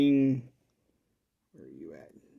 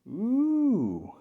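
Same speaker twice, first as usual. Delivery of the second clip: impressed ooh; 10 semitones higher than usual. Real, not acted. Tube radio lights up.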